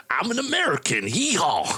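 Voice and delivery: high-pitched, hammed-up Southern US accent; generic Southern American. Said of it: "it's so generically South America".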